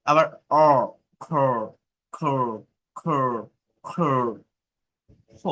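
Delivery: slow isolated sounds like "খ্যাট" and "অ"; vowel drill. Shown as a demonstration. Teacher drilling vowel sounds for students. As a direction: sound out each vowel slowly. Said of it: "আমি প্রত্যেকটা ওয়ার্ডের উচ্চারণ করছি".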